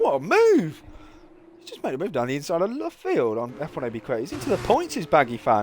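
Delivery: what a move, high and thrilled; sudden burst of delight at the pass; excited race call. Real, not acted. Commentator hails a surprise overtake, thrilled high shout.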